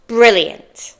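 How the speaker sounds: normal talking voice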